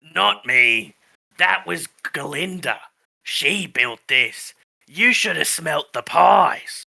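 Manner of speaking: slow, drawn-out put-on creature voice; tree voice. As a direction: savour it slowly as an old tree. GM answers as the tree.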